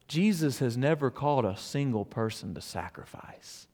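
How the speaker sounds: normal talking voice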